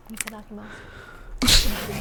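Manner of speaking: slow, drawn-out ASMR Itadakimasu. Shown as a demonstration. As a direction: stretch Itadakimasu out slowly and breathily. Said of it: "Amouranth A S M R vibes".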